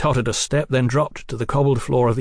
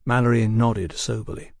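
ordinary delivery, nothing marked

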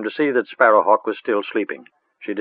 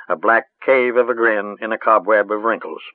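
ordinary delivery, nothing marked

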